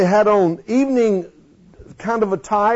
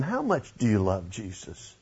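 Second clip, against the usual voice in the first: low, soft, searching question; quiet question; 11 semitones lower, 13 dB quieter than usual. Real, not acted. Preacher asks the congregation softly, a low searching question. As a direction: drop the voice and probe gently. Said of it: "Let me ask you this question".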